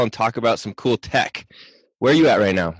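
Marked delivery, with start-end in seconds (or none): none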